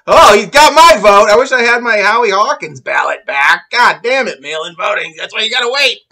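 loud, high sarcastic enthusiasm into mock regret; mock outburst. Host reacting to Biden's donor promise, sarcastic shouting. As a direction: belt it out with sarcastic fervour.

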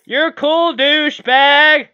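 loud sneering insult; sarcastic taunt. Real, not acted. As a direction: jeer at the other player. Taunting an enemy player.

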